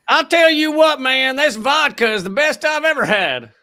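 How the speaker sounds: high, hammed-up Southern accent; Southern good old boy praising Russian vodka. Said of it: "Southern and Russian are very easily".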